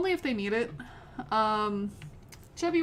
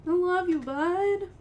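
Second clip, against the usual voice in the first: tender; 8 semitones higher, 5 dB louder, slower than usual. Real, not acted. Emotional to cat.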